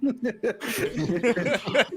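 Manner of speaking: loud high laugh. Real, not acted. Laughs at shirt joke.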